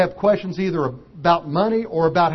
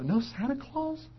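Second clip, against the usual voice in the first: high, soft, slow heartbroken question; small voice of disbelief; crushed little boy; 9 semitones higher, 8 dB quieter, slower than usual. Retold or imitated. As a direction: ask it like a child whose world just broke.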